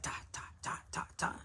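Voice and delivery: whispered ta ta ta, hushed and hidden; secretive whisper. Retold or imitated. Showing how vendors became a secret, hushed whisper.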